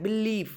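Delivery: Believe with a long, falling, stressed लीव; stress on second syllable. Shown as a demonstration. Teacher showing the correct way, stressed second syllable. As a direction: lean into लीव and stretch it. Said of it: "वो लीव के ऊपर डाल रही हूँ".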